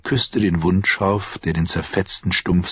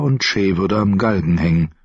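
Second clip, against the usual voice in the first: ordinary delivery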